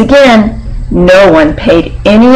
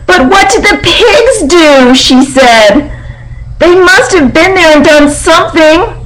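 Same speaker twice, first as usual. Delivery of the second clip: high, insistent little piglet; 8 semitones higher than usual. Acted. Piglet insisting to the barn animals, high and stubborn. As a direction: push the question up high like a stubborn small pig.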